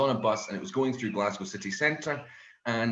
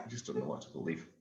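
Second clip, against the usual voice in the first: defeated mutter; 8 semitones lower, 10 dB quieter, faster than usual. Real, not acted.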